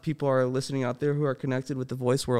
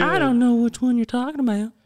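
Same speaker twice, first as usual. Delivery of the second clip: put-on much higher, nerdy boy voice; Cleveland Junior impression; 10 semitones higher, 3 dB louder than usual. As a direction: stay up high like the cartoon kid. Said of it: "Cleveland's son, Junior".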